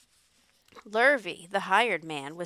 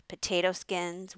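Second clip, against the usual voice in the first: ordinary delivery, nothing marked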